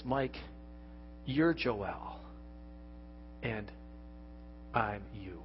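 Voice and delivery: put-on like a father hushing a child to sleep; tender inner voice of God. God's voice speaks in a thought.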